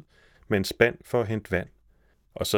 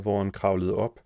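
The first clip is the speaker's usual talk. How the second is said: usual speaking voice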